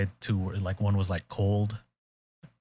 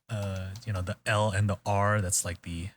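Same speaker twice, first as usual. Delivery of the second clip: ordinary delivery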